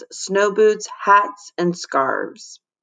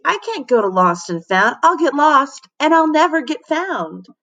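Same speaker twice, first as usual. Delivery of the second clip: panicked; 7 semitones higher than usual. A narrator's reading. Panicked girl.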